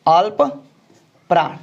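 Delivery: अल्प then प्राण said apart with a long pause; spelled out slowly. Shown as a demonstration. Teacher sounding out अल्प प्राण for the class, slow and separated. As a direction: split अल्प प्राण into two slow words for students. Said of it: "ले लेते हैं हम अल्प प्राण".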